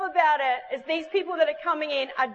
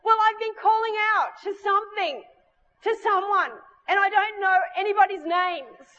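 high, earnest imitation of a searching girl; 7 semitones higher than usual. Girl telling the preacher she has been searching, high and earnest. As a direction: voice a seeker pouring it out, pitched up. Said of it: "and so she goes".

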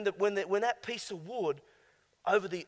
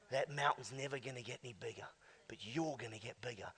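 whispered and intimate; soft whisper; 6 semitones lower, 11 dB quieter than usual. Acted. Preacher whispering to the congregation, hushed. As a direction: lean in and whisper it.

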